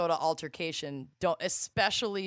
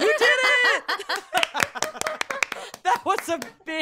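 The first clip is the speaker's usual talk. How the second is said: excited cheer; 6 dB louder than usual. Real, not acted. Cheering a successful attempt.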